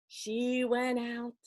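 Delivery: ordinary delivery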